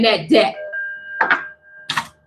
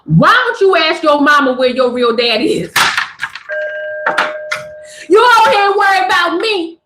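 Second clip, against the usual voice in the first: sassy comeback read; 4 semitones higher than usual. Pretend. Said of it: "You can start off by saying".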